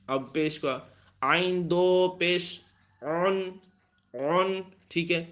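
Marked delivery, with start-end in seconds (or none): 1.2-4.6 اون with a squeezed throat onset, slow; folded ain. Shown as a demonstration. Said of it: "عین میں آواز تھوڑی سی fold ہو جاتی ہے".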